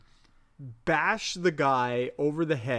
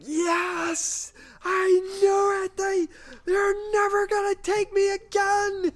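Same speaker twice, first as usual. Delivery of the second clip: frantic high shriek, much higher and louder; freaking out; 4 dB louder than usual. Retold or imitated. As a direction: yell it like a man who finally got proof. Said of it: "like, freaking out".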